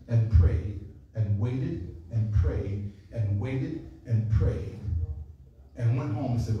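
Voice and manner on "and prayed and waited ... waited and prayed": slow, low, weary drawl; dragging repetition. Acted. Preacher showing endless waiting, slow and low. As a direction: drag it out to feel the wait. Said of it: "drawn-out repetition".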